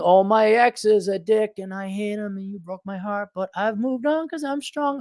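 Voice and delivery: high mock-sincere breakup anthem voice. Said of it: "when [name] sings her famous song".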